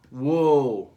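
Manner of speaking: loud amazed whoa. Acted. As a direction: gasp it out in wonder. Reacting to the lab.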